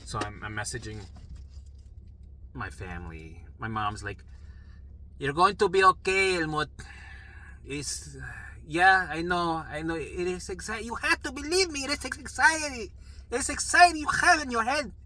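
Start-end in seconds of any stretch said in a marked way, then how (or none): 5.2-14.9 high impression of a mother pleading, growing louder; insistent mother. Mom telling son it is anxiety, insistent pleading. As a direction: build from firm reassurance to high shrieked insistence.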